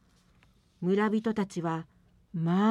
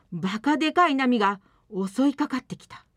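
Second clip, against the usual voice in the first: usual speaking voice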